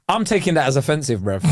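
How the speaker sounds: ordinary delivery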